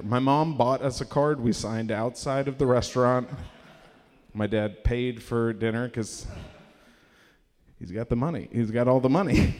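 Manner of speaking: ordinary delivery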